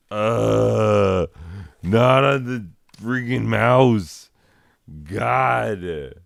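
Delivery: loud exasperated groan into a scolding; real irritation at a pet in the way. Real, not acted. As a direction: scold the cat, fed up. Scolding cat walking on mouse.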